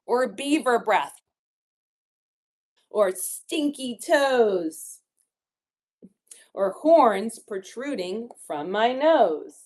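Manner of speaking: picture-book rhyme reading, key words punched; children's story reading. A narrator's reading. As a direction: read the rhymes with big playful swoops in pitch. Reads rhyming book to children.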